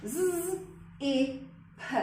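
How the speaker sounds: "z", "e", "p" said as single separate sounds with a pause after each; each sound of zip given alone, slowly. Shown as a demonstration. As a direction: segment the word sound by sound for a child. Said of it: "we need to be able to split those sounds".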